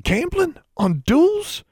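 very high-pitched shocked disbelief; scandalized. A narrator's reading. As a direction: gasp it out, utterly scandalized. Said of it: "looked scandalized".

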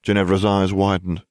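ordinary delivery

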